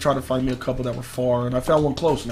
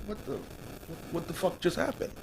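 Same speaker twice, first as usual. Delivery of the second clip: whispered disbelief; 2 semitones higher, 15 dB quieter than usual. Retold or imitated. Stunned after he hung up.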